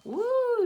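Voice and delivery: high, loud joyful woo, like flying downhill; thrilled high whoop; woo of delight. Pretend. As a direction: whoop it out as the sledder. Voicing child's first sled ride.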